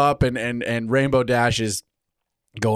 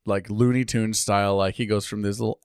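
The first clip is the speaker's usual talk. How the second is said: ordinary talk, nothing marked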